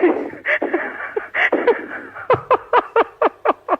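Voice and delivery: long, high helpless laugh; high, airy giggling fit that keeps going; real laughing fit. Real, not acted. Woman cracking up at an absurd prank caller, helpless laughter.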